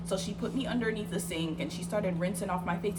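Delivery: normal talking voice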